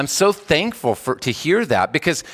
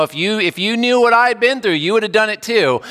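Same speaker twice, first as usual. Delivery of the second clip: higher and louder, imitating someone justifying themselves; mock defensive excuse; 8 semitones higher, 3 dB louder than usual. Pastor mimics people excusing themselves, higher and louder. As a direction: play the indignant self-justifier.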